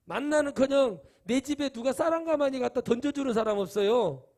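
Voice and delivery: loud, driving sermon shout; the pastor thunders at the church, loud and insistent; fiery preaching. Acted. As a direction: hammer each phrase at the congregation. Preaching builds to full force.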